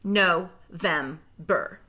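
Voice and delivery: slow, split into syllables; No-vember said slowly, split at the syllable break; segmented syllables. Shown as a demonstration. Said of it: "say the syllables in segments".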